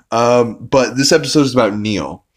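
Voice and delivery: ordinary delivery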